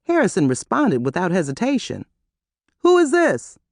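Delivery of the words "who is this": startled. A narrator's reading. Harrison startled at the buzzer.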